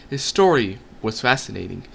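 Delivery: usual speaking voice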